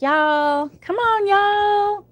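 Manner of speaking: put-on Southern accent. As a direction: ham up a Tennessee y'all call, slow and high. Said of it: "start talking like".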